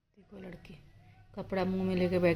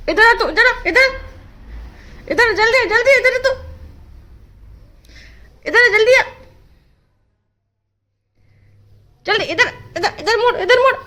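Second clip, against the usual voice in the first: calling the dog; 14 semitones higher, 8 dB louder than usual. Real, not acted.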